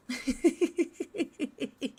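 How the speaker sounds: high, soft run of giggles; giggling. Real, not acted. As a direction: giggle helplessly at your own prank. Amused by own prank.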